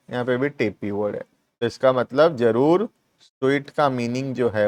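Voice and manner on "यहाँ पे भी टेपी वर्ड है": quick soft repeat. Shown as a demonstration. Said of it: "quick soft repeat".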